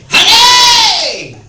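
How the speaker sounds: high, loud call across the house. Retold or imitated. Tied-up boyfriend calling downstairs to girlfriend, loud high call. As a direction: yell for her from upstairs.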